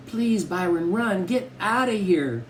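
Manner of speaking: put-on high-pitched urgent little girl; frightened pleading. A narrator's reading. Little sister begs brother to run, high and frightened. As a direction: beg him in a high voice.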